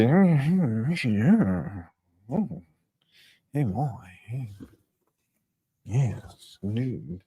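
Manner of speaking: mumbled mock British accent. Shown as a demonstration. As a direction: mumble like a caricatured Brit. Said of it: "That's how you do a British accent".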